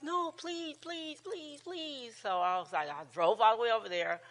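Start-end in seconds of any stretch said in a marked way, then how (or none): 0.0-2.1 begging granddaughter. Retold or imitated.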